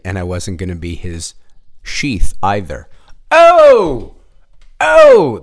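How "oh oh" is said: big, loud "oh" burst; loud caffeinated whoop. Real, not acted. Podcaster feeling the coffee kick in, loud whoop. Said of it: "The coffee's kicking in".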